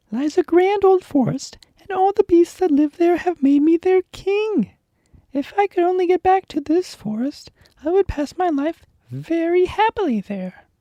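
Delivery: the Lion's voice. Acted. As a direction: play the lion as a gentle storybook beast. Lion voiced in story.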